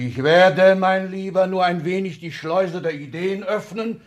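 loud, high triumphant declaration; grandiose boast. Acted. Exzellenz boasts to his secretary, loud and high. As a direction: trumpet it like a victory.

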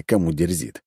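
plain reading voice

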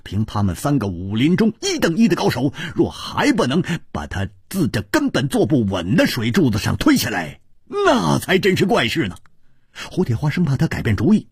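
ordinary delivery, nothing marked

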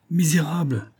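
high-pitched insult; indignant cry. A narrator's reading. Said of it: "crièrent les autres".

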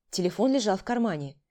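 ordinary delivery, nothing marked

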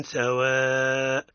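drawn-out سواء with long madd; tajweed recitation. Shown as a demonstration. Teacher showing the rule on سواء, slow recitation. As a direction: stretch سواء slowly as in recitation. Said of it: "يقرأ هكذا".

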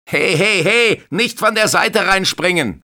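high, loud repeated warning; scolding shout. Acted. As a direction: lifeguard yelling at the kids again. Lifeguard yelling at children. (Bademeister ruft die Kinder laut zur Ordnung)

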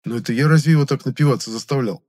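быстрый высокий удивлённый вопрос; спроси с недоумением, торопливо; удивлённо. A narrator's reading. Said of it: "удивился я". (удивлённое возражение)

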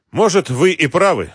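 ordinary delivery

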